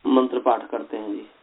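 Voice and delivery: normal talking voice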